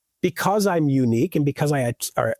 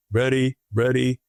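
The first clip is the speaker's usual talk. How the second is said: slow drawn-out ready; 3 semitones lower, slower than usual. Retold or imitated.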